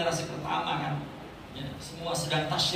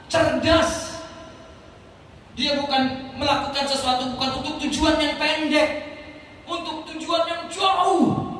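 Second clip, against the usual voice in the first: loud, high, driving sermon delivery; full-force preaching; 4 semitones higher, 4 dB louder than usual. Acted. Preacher rousing the congregation about the spiritual soul, loud and high. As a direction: hammer each nggak ada bahasa.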